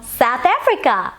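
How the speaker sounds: put-on South African accent, drawn out with a pitch swoop. Vlogger signing off a viewer's question, playful South African accent. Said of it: "That was my South African accent".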